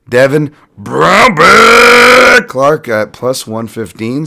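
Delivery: drawn-out ring announcer call; announcer shout. Acted. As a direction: belt the name like a fight announcer. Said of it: "Sorry for your ears".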